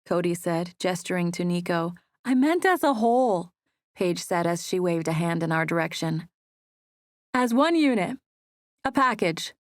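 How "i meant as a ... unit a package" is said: lightly voiced Paige. A narrator's reading. Paige explaining herself.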